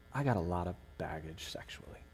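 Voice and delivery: hushed, low whisper of a hard admission; whispered confession. Retold or imitated. Young man dreading telling his girlfriend, whispered. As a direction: breathe it out like a secret. Confession recalled under breath.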